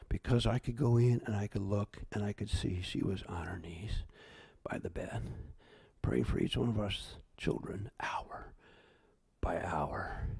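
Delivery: hushed, whispered through tears; barely holding back tears; tearful whisper. Real, not acted. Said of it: "I'm coming to tears".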